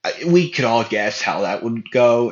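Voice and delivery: ordinary delivery, nothing marked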